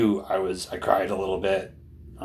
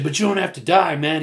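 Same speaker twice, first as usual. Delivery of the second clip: high, louder imitation of pleading; retold heated plea; 7 semitones higher, 5 dB louder than usual. Younger man pleading with older protester, imitated urgency. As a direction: plead urgently while retelling the quarrel.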